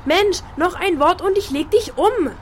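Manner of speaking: high, loud, furious threat. Acted. Girl to teasing big sister, furious threat. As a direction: yell the threat at her.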